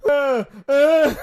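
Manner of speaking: high, loud mock moaning; high groans of a floored fighter; hammed-up dazed wail; imitated knocked-out groan. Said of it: "the guy's on the floor going".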